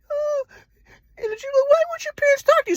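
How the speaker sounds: high, mock tearful girlfriend voice; crying girlfriend imitation. Imitating his crying girlfriend, high and mocking. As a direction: squeak it out high like she's tearing up. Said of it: "she starts to cry".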